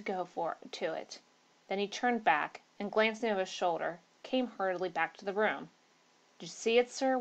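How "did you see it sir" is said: usual speaking voice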